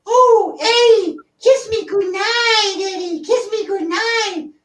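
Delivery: very high, slow, pleading mouse squeak; high puppet squeak, slow and wheedling; Topo Gigio voice. Retold or imitated. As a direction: beg sweetly for a goodnight kiss. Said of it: "I can't do Topo Gigio".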